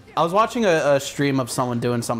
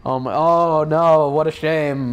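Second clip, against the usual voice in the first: slow, mock-pitying sigh; gloating fake sympathy; 3 dB louder, slower than usual. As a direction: pretend to feel sorry, gloating. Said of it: "Fuck you, you're playing the game".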